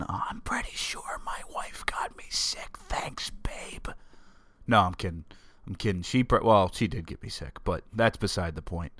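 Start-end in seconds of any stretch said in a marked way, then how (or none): 0.0-3.9 hushed whispered aside. Acted. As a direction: whisper it like a secret jab. Playful aside blaming wife.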